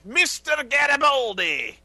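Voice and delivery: a slow, high put-on accent, loud; Londo's strange accent. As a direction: draw the name out high and slow. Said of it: "whenever he said".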